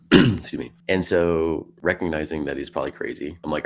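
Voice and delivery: ordinary talk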